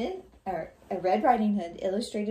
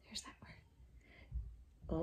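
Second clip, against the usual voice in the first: soft unvoiced whisper; whispered aside; 15 dB quieter, much slower than usual. Real, not acted. Teacher whispering to her class about the vocabulary word. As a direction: slip it in as a secret aside.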